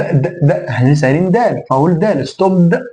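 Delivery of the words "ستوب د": "ستوب" with a voiced d-uh pushed on the end, pitched up; wrong D ending. Shown as a demonstration. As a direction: sound the D fully after "ستوب". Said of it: "لا، ما اقولش ستوب".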